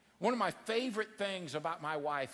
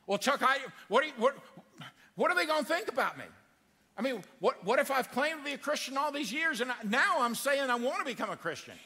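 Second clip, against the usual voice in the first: anxious worrier; 7 semitones higher, 4 dB louder than usual. Retold or imitated. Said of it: "what if they look at me and think".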